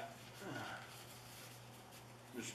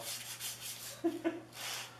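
breathy laugh; 7 dB quieter than usual. Real, not acted. Laughs at own weight joke.

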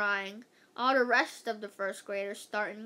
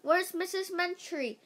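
high, restrained searching yell; 8 semitones higher than usual. A narrator's reading. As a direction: call out high for someone missing. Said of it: "everybody was yelling".

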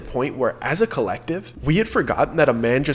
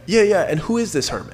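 higher, dismissive yeah yeah; condescending; 6 semitones higher, 3 dB louder than usual. Retold or imitated. Mocking the guide's hermit story, condescending. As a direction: talk down to him like he's a child. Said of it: "I condescendingly say".

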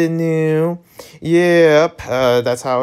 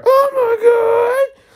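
shocked cry; 3 dB louder, much slower than usual. Acted. Shocked reaction in a game.

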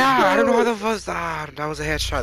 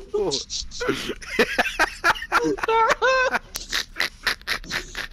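high, softer laugh; breathless giggle; 13 semitones higher, 4 dB quieter than usual. Real, not acted.

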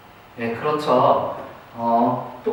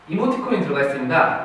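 usual speaking voice

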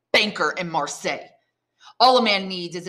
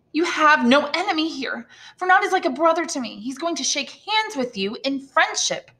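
indignant; 10 semitones higher than usual. Acted. Indignant Mercedes insisting.

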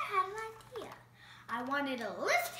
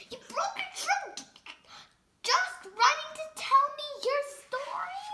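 much higher and louder, amazed; astonished; 10 semitones higher, 6 dB louder than usual. Acted. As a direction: squeal it in disbelief. Piggy astonished.

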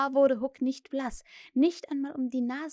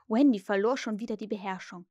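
plain reading voice

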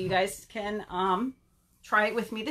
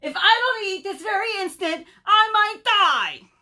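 high, loud melodramatic wail; angry; 12 semitones higher, 9 dB louder than usual. Acted. Starving Bird threatens collapse to his friends, angry wailing. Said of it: "he's getting angry".

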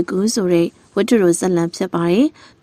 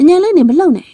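sharp high urgent hush; urgent warning; 9 semitones higher, 4 dB louder than usual. Acted. Villager warning a friend to stay still, urgent. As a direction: warn them to freeze. Villager warns not to move.

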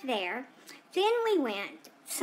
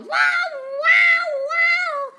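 very high, loud wailing wows; imitated car alarm; 11 dB louder, much slower than usual. Dog mimics the car going off as it opens, shrill alarm wail. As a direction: blare it out like a siren. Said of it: "The car goes".

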